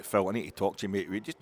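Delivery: ordinary talk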